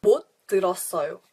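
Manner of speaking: quick conversational speed; fast everyday Korean; fast natural. Shown as a demonstration. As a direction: rattle it off the way a native would. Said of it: "That's pretty fast".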